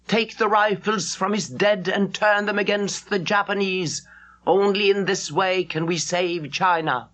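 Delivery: stern army orders. Acted. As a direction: bark out war orders to the people.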